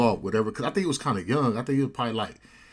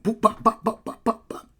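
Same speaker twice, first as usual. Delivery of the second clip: high, popping punch noises; rapid-fire blows sounded out with the mouth; imitated punches; 11 semitones higher, 3 dB louder than usual. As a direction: pop out each hit like a flurry of fists. Imitates punches landing in fight.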